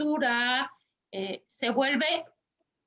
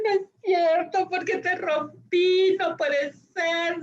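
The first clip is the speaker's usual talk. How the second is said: higher, louder, slow mock crying child; 6 semitones higher, 4 dB louder, slower than usual. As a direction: play the kid sorry he broke his toy, tearfully. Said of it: "y dice".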